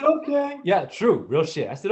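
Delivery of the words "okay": put-on a high eager okay, like a trusting kid; little kid voice, much higher; naive little boy. Little Jay trusting his big brother, high eager. Young self agreeing in story.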